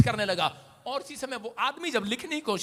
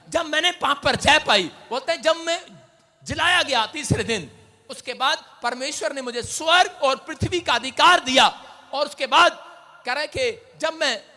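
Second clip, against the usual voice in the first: preaching; 2 semitones higher, 12 dB louder than usual. Acted. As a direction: preach it out to the congregation. Preaching at full force.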